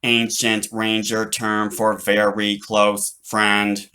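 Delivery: slow, even machine voice; robot voice. Acted. Robot explains the term, slow and mechanical. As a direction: define it like a dictionary.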